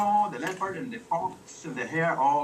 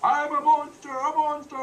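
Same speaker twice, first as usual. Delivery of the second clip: high, louder monster declaration; booming monster; 11 semitones higher, 5 dB louder than usual. Acted. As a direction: boom it like a storybook monster. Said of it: "came the voice".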